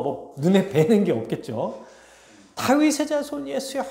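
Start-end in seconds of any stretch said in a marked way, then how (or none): 2.6-3.8 high, strained mock shout at contained volume; crying out. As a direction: call out desperately on one high pitch. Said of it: "이렇게 외치는 거예요".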